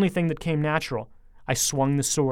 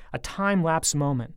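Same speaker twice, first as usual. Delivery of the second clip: ordinary delivery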